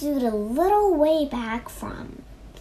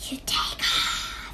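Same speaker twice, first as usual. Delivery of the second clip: whispered snake voice; airy snake hiss; 4 dB quieter than usual. A narrator's reading. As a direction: whisper it like a sly storybook snake. Snake voiced again after hissing.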